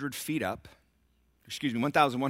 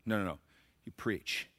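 ordinary delivery

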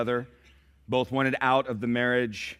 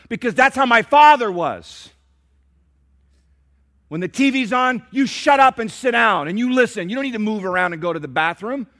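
much higher, louder barked commands; harsh fatherly orders; 11 semitones higher, 11 dB louder than usual. Retold or imitated. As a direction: lay down the law like his strict father. Angry husband quoting his father.

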